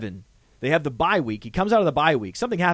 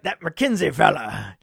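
slow, badly faked Scottish burr; attempted Scottish accent. Host fondly naming a player, in mock Scottish. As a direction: put on a clumsy Scottish accent. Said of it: "I try to do Scottish".

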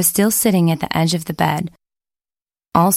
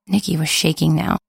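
ordinary delivery, nothing marked

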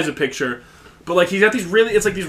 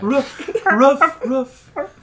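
dog barking imitation; 7 semitones higher, much slower than usual. Police dog in skit.

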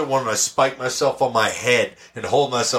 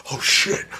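hushed, breathy oh shit; whispered oh shit; 4 semitones higher than usual. Retold or imitated. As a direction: whisper it like you've been caught off guard. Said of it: "And we'd be like".

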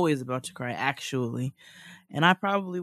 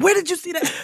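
irritated retort; 7 dB louder, faster than usual. Retold or imitated. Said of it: "I be like".

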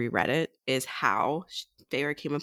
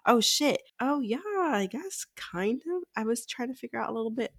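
mock realization; 10 semitones higher than usual. Podcaster imagines the heroine catching on, high mock realization.

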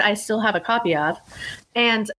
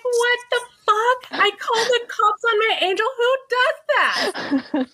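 much higher, teary laugh through the words; laugh-cry at your own mistake; laughing disbelief. Retold or imitated. Guest laughing at herself for calling cops, squeaky disbelief. Realizes she called cops on angel.

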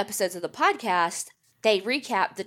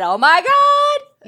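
slow high squealed oh my God; fangirl squeal; 10 dB louder, slower than usual. Retold or imitated. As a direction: gush it out, loud and high. Their fangirl reaction acted out.

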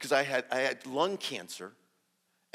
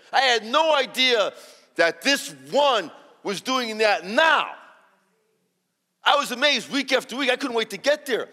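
high, loud, exclaiming wonder; amazed excitement; 7 semitones higher, 11 dB louder than usual. Retold or imitated. Speaker tells congregation of witnessing healings, loudly amazed. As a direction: pour out amazement at full volume.

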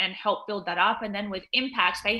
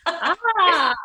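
loud, high drawn-out "aah"; delighted "ah"; 8 semitones higher, 11 dB louder than usual. Real, not acted. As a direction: exclaim a long, high "aah". Loud delighted reaction to question.

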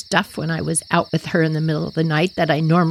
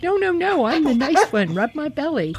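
high, eager happy dog voice; friendly dog begging for a belly rub; lightly voiced cheerful dog; dog's voice; 8 semitones higher than usual. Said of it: "She's like".

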